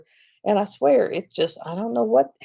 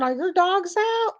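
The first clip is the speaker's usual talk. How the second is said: imitated yell; 13 semitones higher than usual. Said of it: "and he's yelling".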